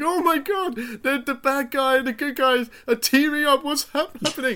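high, excited mock gasp at the twist; mock shock. Friend retelling the film, squealing in mock shock. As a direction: squeal as if the villains teaming up is unbelievable. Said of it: "and it's like".

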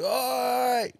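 high calling out. Retold or imitated. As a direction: shout to flag a mate down. Calling out to passing friend.

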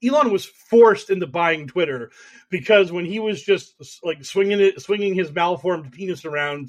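usual speaking voice

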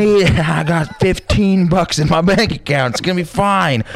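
high, cocky careless voice; drunk bravado. Retold or imitated. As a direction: brush it off like a drunk who doesn't care.

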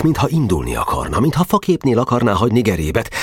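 ordinary delivery

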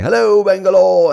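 high, loud British stage greeting; exaggerated British accent. Comedian greeting the Bangalore crowd, exaggerated British accent. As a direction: holler it like a British showman. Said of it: "suddenly fucking this British bacha".

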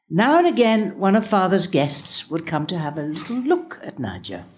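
usual speaking voice

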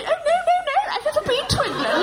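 very high, squeaky mock-posh parroting; children's mocking mimicry. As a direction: parrot the victim back in a mocking falsetto. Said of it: "And they'd say".

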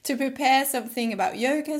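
usual speaking voice

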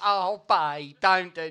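loud, high mock-sweet "Oh, babe, don't do it"; a couple pretending to be nice mid-row, hollow and loud. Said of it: "It's hollow".

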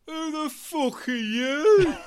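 high, drawn-out incredulous bellow; outraged shout. Acted. Whole band to the drummer jumping in, outraged bellow. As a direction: bellow it slowly at the intruder. Band greets stranger climbing into van.

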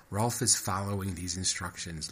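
plain reading voice

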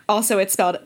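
ordinary talk, nothing marked

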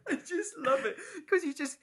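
high laughter into the words; laughing. Real, not acted. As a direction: laugh through it helplessly. Laughing at the memory.

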